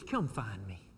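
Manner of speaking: quiet plea. Retold or imitated. Pastor voicing the psalmist: hushed, low plea. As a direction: murmur it, barely there. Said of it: "He says".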